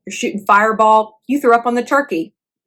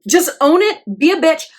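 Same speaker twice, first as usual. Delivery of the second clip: high-pitched, emphatic exhortation; Howard Stern; 6 semitones higher than usual. Retold or imitated. Retelling a radio host's advice, emphatic urging. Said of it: "He's like".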